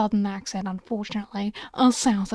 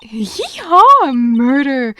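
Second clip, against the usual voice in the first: whooping reporter; 3 semitones higher, 14 dB louder, slower than usual. Acted. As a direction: whoop with glee at the scoop. Reporter celebrates a scoop.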